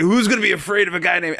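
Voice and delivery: high and fast mocking; guy's mocking voice. The customer scoffing at the mob boss, high and fast. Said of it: "And he was like".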